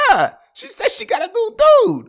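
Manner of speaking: very high, loud mock protest; exasperated friend. Friend telling him his ex moved on, comically exasperated. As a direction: imitate a buddy squealing in exasperation.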